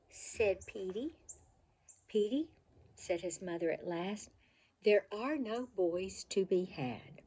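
put-on bright, lively storybook reading for kids; reading to children. A narrator's reading. Reading a favorite childhood book to children, lively storybook voice. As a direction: read it like a bedtime picture book, full of expression.

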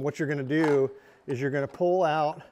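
usual speaking voice

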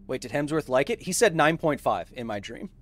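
ordinary delivery

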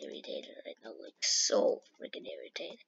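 whispered under the breath in real annoyance; irritated whisper. Real, not acted. Gamer muttering his irritation, annoyed whisper. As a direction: hiss it quietly to yourself.

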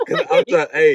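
high laughing voice; laughing through it. Real, not acted. Laughing while explaining.

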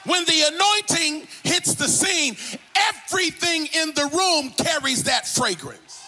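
preaching that climbs high by the end; fervent preacher building momentum; full-force preaching. Real, not acted.

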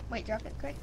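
usual speaking voice

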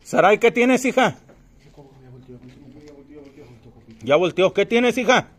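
loud high call into the dark. Real, not acted. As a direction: shout her name from afar. Calling out to the friend.

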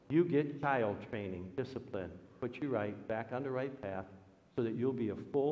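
ordinary talk

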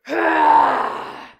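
loud mock-panic yell; shout. Narrator yells to the children to run from the lion. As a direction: yell for everyone to flee.

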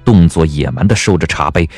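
normal talking voice

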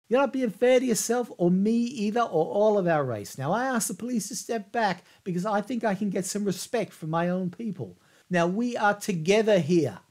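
rally them together; impassioned appeal. Retold or imitated. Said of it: "Brown appeals to the crowd".

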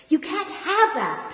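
high, loud, slow shout. A narrator's reading. Pat shouts at the brunette over the cage, yelling. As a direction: stake the claim at full volume. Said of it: "Pat shouts".

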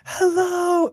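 very high frontman's hello; mock stage greeting. Mimicking a singer greeting the crowd before a bad edit, high stage call. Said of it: "just have it be like".

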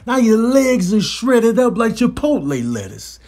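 ordinary talk, nothing marked

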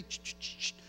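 whispered hushing sound; shushing. Retold or imitated. Elders hushing a talking child in church, whispered shush. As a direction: hush a chattering kid in church. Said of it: "would come and say".

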